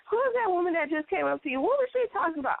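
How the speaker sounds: high, nosy mother's voice; nosy mom. Retold or imitated. Mom asks her daughter about a stranger, high and nosy. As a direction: pitch it up like a prying mom. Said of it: "my mom is like".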